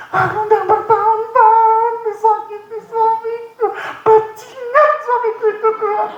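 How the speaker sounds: high, slow, hammed-up wailing prayer; mock crying prayer. Wife prays in her room about her cruel husband, mock wailing. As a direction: wail it out like a wronged wife begging God.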